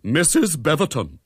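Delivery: high, loud scolding; schoolmistressy rebuke; stern reprimand. A narrator's reading. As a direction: address her like an unruly choirboy. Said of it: "in the voice that she generally kept for unruly choir boys".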